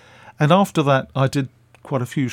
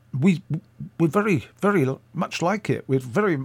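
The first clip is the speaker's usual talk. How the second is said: put-on faltering Scottish accent, pitched up; attempted Scottish accent; 5 semitones higher than usual. Radio man praising her tape, in a badly faked Scottish accent. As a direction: try a Scottish accent and stumble over it. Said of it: "I can't do it. I'll, I'll go back to English".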